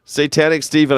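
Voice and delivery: usual speaking voice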